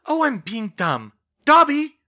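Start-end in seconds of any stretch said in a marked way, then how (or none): 1.5-2.0 very high, loud summons; loud, high-pitched call. A narrator's reading. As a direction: call out for someone sharply. Calling the elf to appear.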